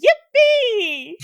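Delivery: high, loud cartoon "Yippee!"; Mario yippee. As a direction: squeal it like Mario jumping. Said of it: "when they was like".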